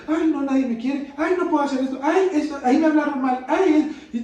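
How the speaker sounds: hammed-up poor-me whine, half laughing; mock lament of someone feeling unloved; whiny self-pity. Mocking self-pity quote.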